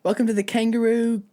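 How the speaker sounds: high-pitched bad Australian welcome; hammed-up, faltering Australian accent; badly faked Australian, playing it up. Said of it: "and I'm from Australia".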